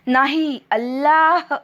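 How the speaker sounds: high, loud, angry correction; furious shout. A narrator's reading. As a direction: shout it in unbearable rage. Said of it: "क्रोध सहन न झाल्याने त्याने आपली मूठ". (संतापाने चढ्या आवाजात ओरडून)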